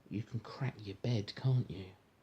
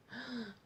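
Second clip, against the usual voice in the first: dramatic gasp; 11 semitones higher, 12 dB quieter than usual. A narrator's reading. Bread squashed in story.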